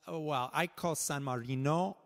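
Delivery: normal talking voice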